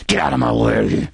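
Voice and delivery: lightly acted gruff tough guy; private bully. Host imitating officials confronted in a hall, mock bullying. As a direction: brush someone off with mock menace. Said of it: "confront 'em in private or when they're going down a hall".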